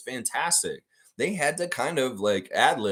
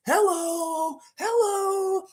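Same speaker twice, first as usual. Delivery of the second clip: much higher falsetto voice, comic; silly falsetto greeting; 5 dB louder than usual. Retold or imitated. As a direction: squeak it like a cartoon. Mimicking greeting to Jack.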